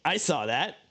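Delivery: high, slow gloating voice for the game queue; scene: the game queue mocking a player who left, gloating villain; taunting villain queue. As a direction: play the queue as a smug cartoon villain, laughing at the end. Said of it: "Q's like".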